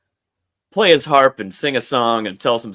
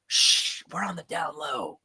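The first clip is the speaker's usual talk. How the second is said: hushed whisper; 3 semitones higher, 7 dB quieter than usual. Retold or imitated. Shushing the captain to stay hidden, hushed whisper. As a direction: whisper the shh, then much softer.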